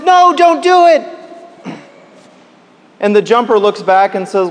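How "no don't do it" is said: pleading call. Retold or imitated. Driver pleads with man on bridge.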